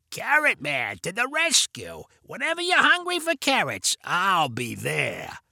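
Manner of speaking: slow, swooping raspy superhero voice; Carrot Man. A narrator's reading. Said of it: "Vinny kept going with the voice".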